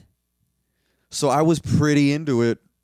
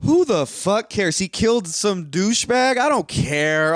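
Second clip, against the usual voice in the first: ordinary delivery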